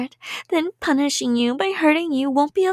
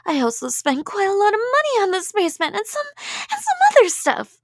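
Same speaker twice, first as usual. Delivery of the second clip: unhinged; 7 semitones higher, faster than usual. Acted. Yandere rant about money spent.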